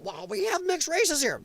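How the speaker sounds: high-pitched mock whine; mocking objection. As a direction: parody someone's objection in a high voice. Mocks people's objection.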